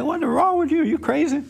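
as if shocked at a friend's nonsense; incredulous. Retold or imitated. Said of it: "I'm like".

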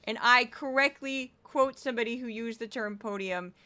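ordinary talk

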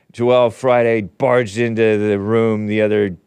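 ordinary delivery

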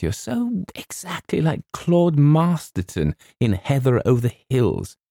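put-on high, gushing girl's voice; girlish adoration. A narrator's reading. Girl likens James to a novel's hero, gushing.

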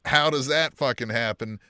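normal talking voice

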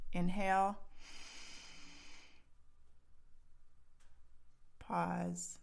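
slow guided breath cues; slow breath counting. Shown as a demonstration. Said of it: "Here we go".

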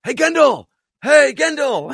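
high, loud call across the street; calling out Gendel across a busy street; shouting a name. Retold or imitated. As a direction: shout to get his attention from afar. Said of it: "somebody shouts".

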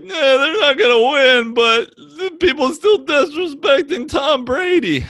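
high, loud mocking voice; whiny mock of a friend; Matty. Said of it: "And he's like".

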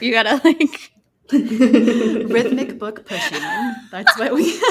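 giggling through the words; laughing. Real, not acted. Joking with coworkers about cart gymnastics, giggling. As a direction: laugh while you joke it out.

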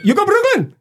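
high-pitched broken-English cabbie; cabdriver's foreign accent. Retold or imitated. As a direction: imitate a heavily accented immigrant cabdriver. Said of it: "some guy who literally just was".

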